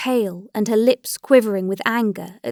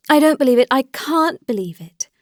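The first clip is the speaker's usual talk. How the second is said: higher, louder emphatic denial; vehement disbelief; 4 semitones higher, 5 dB louder than usual. A narrator's reading. Dolly insists to Alexei about Anna, in vehement disbelief. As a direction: insist forcefully, hitting the first words hard. Dolly refuses it, more forcefully.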